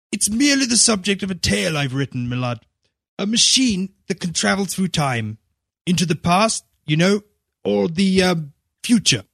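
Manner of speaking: shy author explaining his tale; lightly voiced, embarrassed Wells; embarrassed writer Wells. A narrator's reading.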